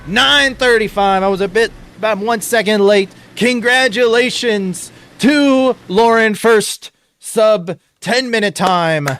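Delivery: loud, rising hype commentary; excited play-by-play. Acted. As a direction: call the finish like a sportscaster. Live play-by-play at the finish.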